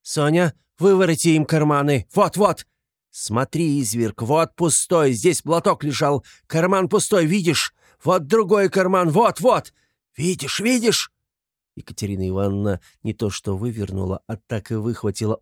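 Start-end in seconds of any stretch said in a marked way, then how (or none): 0.0-11.1 high, triumphant, agitated cries; shouting. A narrator's reading. Katerina showing Luzhin the empty pockets, shouting. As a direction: cry out each proof with rising pitch. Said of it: "кричала Катерина Ивановна".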